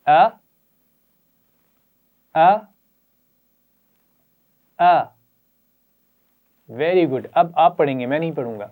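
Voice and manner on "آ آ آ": a clean short glottal "آ" from the throat; glottal a sound. Shown as a demonstration. Teacher modelling "آ" for the children, crisp glottal a. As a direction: say "آ" as a crisp glottal stop plus a. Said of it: "سب پڑھیں گے".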